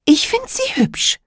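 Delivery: put-on high little girl voice; a child answering simply. Emma's child voice. (Mädchenstimme)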